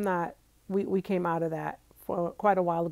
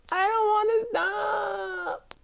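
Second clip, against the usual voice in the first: much higher, slow whiny shout; bratty; 14 semitones higher, much slower than usual. Pretend. To her viewers, refusing to end the video, bratty whine. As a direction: whine it like a bratty kid who won't quit. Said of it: "Kind of being bratty right now".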